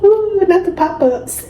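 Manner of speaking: high, squealing ooh of glee; giddy delight. Real, not acted. Reader gushing to viewers about a favourite trope, giddy delight. Teasing reaction to romance.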